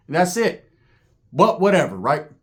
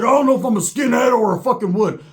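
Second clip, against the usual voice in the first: high-pitched, agitated declaration; imitated worked-up rant; 6 semitones higher than usual. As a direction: blurt it high and heated. Roach rants about his own identity.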